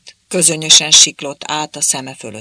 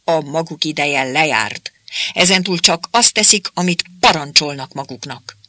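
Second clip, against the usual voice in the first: screeching rant; 3 semitones higher, 6 dB louder than usual. A narrator's reading. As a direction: scream him down, furious. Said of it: "elvörösödött és rikácsolni kezdett".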